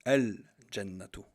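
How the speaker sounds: slow "Al-Jannatu" with a distinct held L; lam pronounced. Shown as a demonstration. Teacher correcting toward the sounded lam, slow and distinct. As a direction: separate the L from the J in "Al-Jannatu". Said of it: "on dit".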